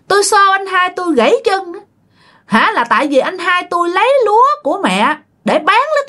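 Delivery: high-pitched, self-important ghost voice; boastful spirit. Retold or imitated. Spirit tells exorcist of shoving thief and brother, high and boastful. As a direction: boast of mischief in a high character voice.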